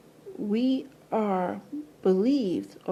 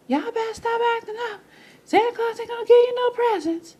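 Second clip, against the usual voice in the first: high, fast, loud mock scolding; scolding parent; 11 semitones higher, 5 dB louder, faster than usual. Parent warning misbehaving kids about Santa, shrill scolding. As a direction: nag the kids like a fed-up parent. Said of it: "your parents and guardians be like".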